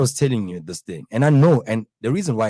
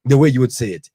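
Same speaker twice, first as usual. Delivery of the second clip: normal talking voice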